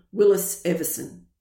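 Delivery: usual speaking voice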